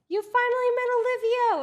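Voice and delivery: high, loud excited squeal; Kaide. Retold or imitated. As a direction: read the text out as a thrilled cheer. Reads out friend's excited text.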